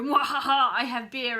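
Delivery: villain laugh and gloat; mad scientist. Pretend. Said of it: "to drink beer at you and go".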